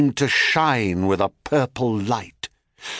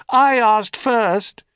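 high, slow echoed reply; helpless echo; 11 semitones higher, 4 dB louder, slower than usual. A narrator's reading. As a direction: echo the words back. Echo repeats.

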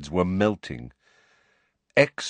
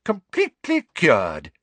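much higher, louder joyful call; bright announcement; 7 semitones higher, 3 dB louder than usual. A narrator's reading. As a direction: proclaim it happily. Mr Weasley voiced.

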